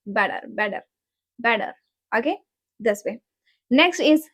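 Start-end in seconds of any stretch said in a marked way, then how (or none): 0.1-1.7 "Better" said as "bedder"; soft D in the middle of "Better"; American T as soft D. Shown as a demonstration. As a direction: tap the T in "Better". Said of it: "But in American, we say".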